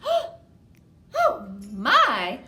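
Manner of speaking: put-on a light old woman's cry; lightly voiced tiny old woman; startled old lady. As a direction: squeak it out like a startled granny. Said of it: "cried the tiny old woman".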